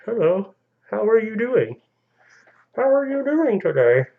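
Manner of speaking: high-pitched put-on pet voice; voice for a dog. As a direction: speak for the dog in a high, silly voice. Voices the dog greeting viewers.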